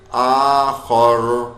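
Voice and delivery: آخر with a heavy, thick ر, drawn out slowly. Shown as a demonstration. Teacher showing the thick ر students should avoid, slowly. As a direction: stretch آخر with a full, thick ر. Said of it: "پھر را موٹا ہو جاتا".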